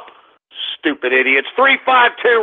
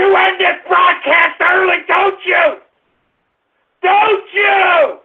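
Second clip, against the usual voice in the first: furious rant; 8 semitones higher than usual. Real, not acted. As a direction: tear into the trolls. Host ranting at trolls.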